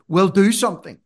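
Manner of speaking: a wife's no-nonsense push; wife's blunt command. Retold or imitated. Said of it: "She just looked at me and said".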